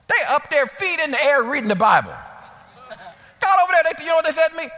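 high-pitched, worked-up storytelling; indignant disbelief. Retold or imitated. Supervisor finding staff reading instead of working, indignant disbelief. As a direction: climb high in outraged amazement. Preacher relives finding the idle employee.

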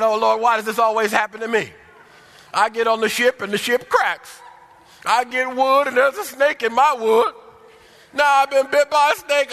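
high, loud whiny panic; complaining Paul. Retold or imitated. Paul bitten by a snake, whining high and loud to the Lord. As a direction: wail it like a man sure he's dying. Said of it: "He started complaining".

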